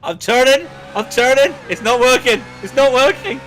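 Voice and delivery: high loud panicked driver. Pretend.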